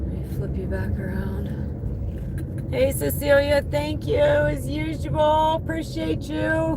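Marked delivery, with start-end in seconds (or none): 2.7-6.8 sweet thank-you. Real, not acted. Thanking customer for the tip.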